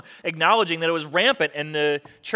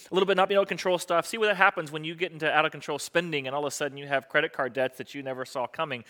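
usual speaking voice

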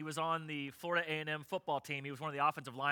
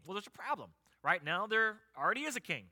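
usual speaking voice

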